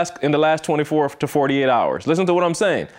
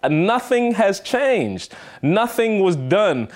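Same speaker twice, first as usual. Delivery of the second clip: slow emphatic insistence; 5 semitones higher, much slower than usual. Real, not acted. Host insists nothing changed.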